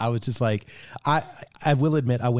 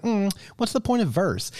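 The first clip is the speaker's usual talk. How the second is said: skeptical; 10 semitones higher than usual. Retold or imitated. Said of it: "And I'm like".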